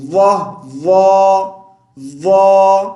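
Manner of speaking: वाह said loud and heavy; sound drill. Shown as a demonstration. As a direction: stress the heavy वाद. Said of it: "वाक को कैसे पढ़ेंगे?".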